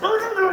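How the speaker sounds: high, strangled arr noises; squeezed high choking sounds; imitated choking. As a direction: gargle like someone being choked. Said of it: "sounded like he was choking".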